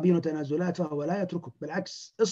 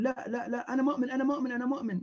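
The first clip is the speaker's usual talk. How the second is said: frightened pleading; 7 semitones higher, 3 dB quieter, faster than usual. Retold or imitated. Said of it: "يغمض يقول".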